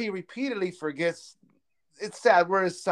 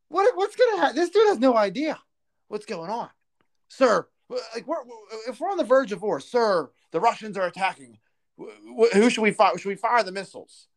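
higher, frantic, stammering mock staffer; mock panicked aide; 4 semitones higher than usual. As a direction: play a flustered aide begging the clueless president for orders. Acting confused Biden aide scene.

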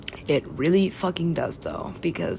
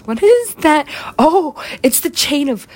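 high, loud, drawn-out wonder; mock amazement; 11 semitones higher, 7 dB louder than usual. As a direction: gasp at it like a discovery.

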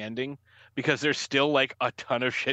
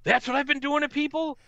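mock sudden remorse; 12 semitones higher, 3 dB louder than usual. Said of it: "He's like".

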